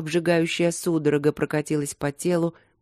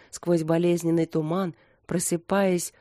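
ordinary delivery

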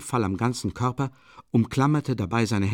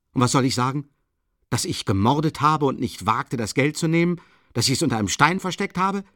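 raised, higher-pitched mockery; scornful; 6 semitones higher, 5 dB louder than usual. A narrator's reading. Murderer ridiculing the idea of confessing, scornful. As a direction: sneer the questions through a mocking smile. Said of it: "mit einem höhnischen Lächeln".

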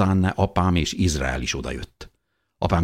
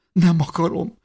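much higher, pleading through tears; tearful plea; 12 semitones higher than usual. Acted. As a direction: beg desperately, voice breaking. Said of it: "rebegte sírva elcsukló hangon". (sírós, kétségbeesett könyörgés)

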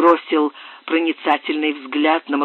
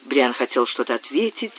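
ordinary delivery, nothing marked

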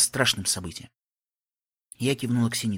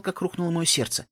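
ordinary delivery, nothing marked

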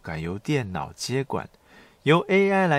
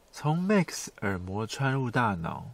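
ordinary talk, nothing marked

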